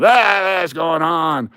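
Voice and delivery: high hollered "Ah," easing into a complaint; mock crowd yelling. Everybody in line started yelling, mock outcry. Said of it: "everybody started yelling".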